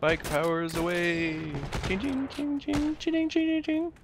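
normal talking voice

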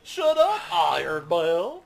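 high, drawn-out silly character voice; odd put-on voice. Player goofing at the game, odd put-on voice. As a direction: put on a loud, high, unplaceable voice. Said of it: "I don't know what that voice is".

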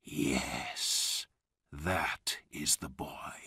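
whispery and low, to himself; quiet. A narrator's reading. Old man confirms his find, quietly. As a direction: murmur it under your breath. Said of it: "he said quietly".

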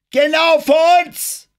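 high, stretched-out warning shout; young nervous cry. A narrator's reading. Lookout answers Driscoll from the crow's nest, high shout. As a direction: yell it loud, very high. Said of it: "nervöse Stimme vom Krähennest".

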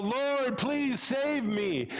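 high, slow plea for rescue; pleading rabbi. Acted. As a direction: call up to God, begging and stretching the words. Rabbi cries from window.

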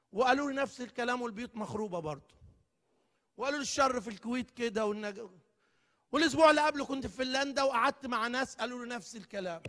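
ordinary talk, nothing marked

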